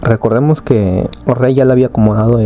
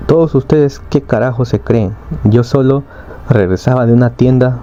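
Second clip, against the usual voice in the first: ordinary delivery, nothing marked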